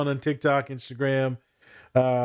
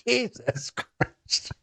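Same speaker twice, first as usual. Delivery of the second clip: exasperated groan; 7 semitones higher, much slower than usual. Real, not acted. Exasperated reaction.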